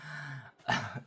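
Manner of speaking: little laugh after an awkward admission; short laugh. Real, not acted. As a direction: laugh it off briefly. Laughs after mentioning challenging conversations.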